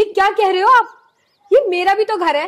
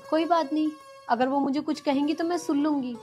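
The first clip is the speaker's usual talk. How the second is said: soft pleading; 3 semitones lower, 5 dB quieter than usual. Acted. Wife calms husband softly.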